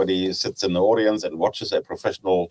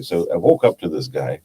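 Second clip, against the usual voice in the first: usual speaking voice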